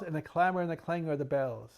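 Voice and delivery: dramatic poetry reading, rising with the bells; theatrical reading of a Victorian poem; poem recital. Acted. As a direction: read it like a spooky Poe recital, building to a frenzy. Reads Poe's The Bells aloud.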